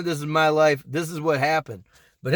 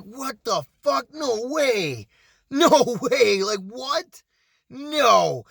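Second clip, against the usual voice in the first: higher-pitched, acted-out incredulity; shocked disbelief; 5 semitones higher, much slower than usual. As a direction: replay your stunned inner reaction. Retold shocked reaction to overheard story.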